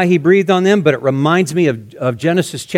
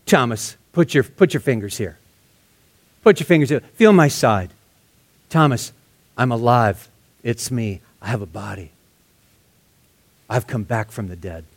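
softer, lower, tender reassurance; gracious and gentle; 5 semitones lower, 3 dB quieter than usual. Acted. Jesus inviting doubting Thomas to touch him, gently. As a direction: coax him gently, like a friend. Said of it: "he's so gracious to him".

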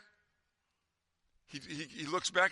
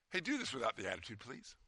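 put-on parent to teenager; 4 semitones lower, 7 dB quieter than usual. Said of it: "like you tell your teenagers sometimes".

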